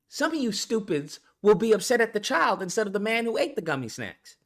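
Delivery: ordinary talk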